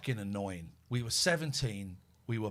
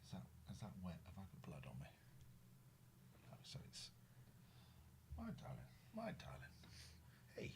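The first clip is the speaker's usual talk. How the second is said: whisper; 6 semitones lower, 23 dB quieter, faster than usual. Real, not acted. Whispering to the injured cat.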